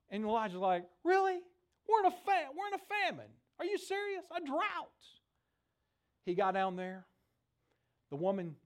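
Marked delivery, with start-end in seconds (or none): 1.0-4.9 incredulous. Retold or imitated. Elijah doubts the widow plan.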